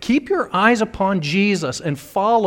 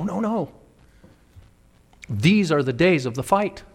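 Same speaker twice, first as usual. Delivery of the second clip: ordinary delivery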